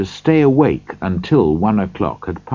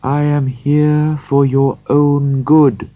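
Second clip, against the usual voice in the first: slow, gentle ghostly voice; 6 semitones higher, 4 dB louder, slower than usual. Acted. The spirit's voice.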